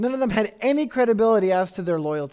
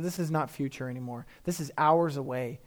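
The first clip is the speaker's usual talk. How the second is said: grave warning; 5 semitones lower, 6 dB quieter than usual. Acted. Jesus warning, quiet.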